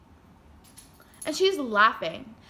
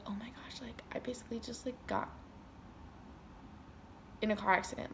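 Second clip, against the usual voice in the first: hushed, near-whispered panic; panicked whisper; 12 dB quieter, faster than usual. Retold or imitated. Speaker panics privately before dance rehearsal, hushed whisper. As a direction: whisper the worry to yourself.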